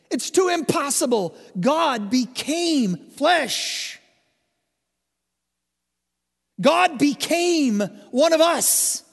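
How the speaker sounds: preacher at full force, stunned by God made flesh; fervent preaching. Real, not acted. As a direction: proclaim it in awe, full force.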